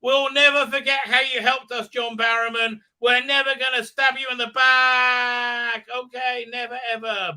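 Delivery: high, loud gushing mock voice; mocking companions thanking a hero; mock farewell speech. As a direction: gush it in parody.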